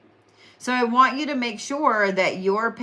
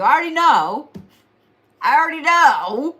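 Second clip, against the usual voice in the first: higher and louder, sing-song I already know; knowing loud tease; 6 semitones higher, 7 dB louder than usual. Acted. Teasing knowing Scorpios.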